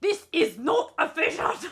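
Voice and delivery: high, loud mock German; German accent. Player obeying the German prompt, loud German accent. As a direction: put on a stern, hammed-up German accent.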